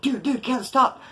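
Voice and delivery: high-pitched pleas to stop; urgently trying to break up a fight; retold urgent pleading. Retold onlookers urging choker to stop.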